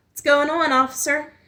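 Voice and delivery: put-on high, loud Southern country-girl greeting; sweet country-girl drawl. A narrator's reading. Young woman playing country girl for the sheriff, sweet Southern drawl. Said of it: "in a drawl as thick and sweet as molasses".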